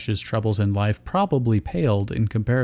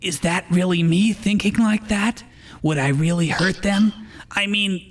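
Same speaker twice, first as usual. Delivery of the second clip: worried inner voice, gently played; lightly voiced Ash; 8 semitones higher than usual. Ash's inner voice continues.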